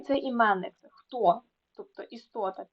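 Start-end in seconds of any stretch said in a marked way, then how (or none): none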